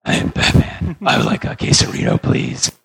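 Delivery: raspy whispered superhero growl; breathy gravel, all rasp no tone; gravelly Batman voice. Retold or imitated. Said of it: "masked with a gravelly voice".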